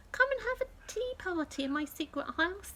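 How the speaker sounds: put-on bright high child invitation; little girl voice. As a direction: sound like an eager little girl. Sally invites friend, child voice.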